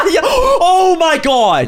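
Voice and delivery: loud, high, drawn-out exclamation; high yell through laughter; laughing outburst. Real, not acted. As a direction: cry out in hilarity, stretching the words. Laughing outburst at co-host's story.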